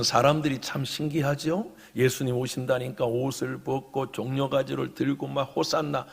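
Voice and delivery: ordinary talk, nothing marked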